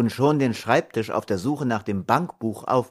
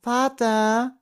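put-on a small boy calling his father, pitched high; high child voice calling out, rising; five-year-old calling for his father; bright child's voice; 14 semitones higher than usual. A narrator's reading. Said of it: "ließ sich Thomas' helle Stimme hören".

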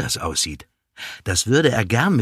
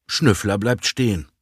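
ordinary delivery

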